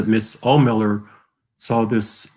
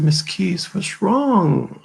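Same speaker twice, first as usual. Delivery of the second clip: higher-pitched concerned student; worried question; 9 semitones higher, much slower than usual. Retold or imitated. Student to teacher who looks mad, worried question. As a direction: ask it high, with mild worry.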